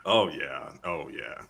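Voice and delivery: low, soft, playful murmur; color commentary. Pretend. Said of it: "just gonna do the color commentary".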